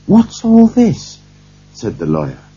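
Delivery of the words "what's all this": high-pitched, slow, astonished challenge; indignant demand. A narrator's reading. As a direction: demand an explanation, pitch right up. Lawyer confronts gathered servants.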